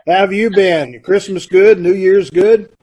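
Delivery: normal talking voice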